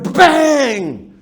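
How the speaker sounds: loud shouted bang of a tire exploding; mock bang. Telling the class about the blowout, a loud bang.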